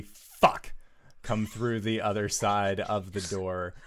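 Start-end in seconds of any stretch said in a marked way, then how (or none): 0.0-0.7 smoky, whispered curse. Acted. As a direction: breathe out a smoky, voiceless curse. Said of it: "a very smoky".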